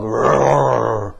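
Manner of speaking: loud mock growl of a big angry dog; angry dog roar. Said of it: "like a big angry dog".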